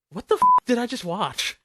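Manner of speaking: loud, high, baffled outburst; baffled shout. Retold or imitated. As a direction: blurt it out in disbelief. Acted baffled reaction quote.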